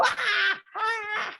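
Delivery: very high mock wail; imitated child's scream. Boy hitting his father, high imitated screaming. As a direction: shriek like a kid in a tantrum.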